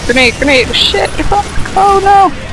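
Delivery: panicked rising grenade yell; grenade warning yell. Real, not acted. Grenade lands in the fight.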